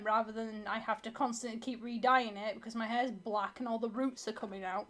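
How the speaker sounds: normal talking voice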